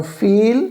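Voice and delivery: फील with a long "ee"; long-vowel example फील; long E sound. Shown as a demonstration. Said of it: "ई की लंबी sound आ रही है".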